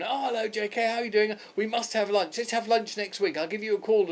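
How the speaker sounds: high, chirpy celebrity greeting; lightly voiced cheery Paul; breezy Paul. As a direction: gush a friendly hello from a car window.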